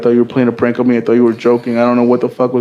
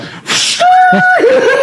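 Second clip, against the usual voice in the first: high, long burst of laughter; high-pitched belly laugh; loud laughter; 3 dB louder than usual. Real, not acted. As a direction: crack up helplessly. Listener cracks up at the story.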